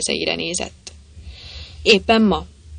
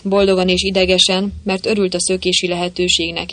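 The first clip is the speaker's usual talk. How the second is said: ordinary delivery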